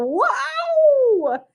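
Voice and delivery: squeaky high little frog wow; a tiny frog, amazed; frog's wow. Pretend. Teacher voicing the frog for her class, high wow. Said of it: "Even the frog's going".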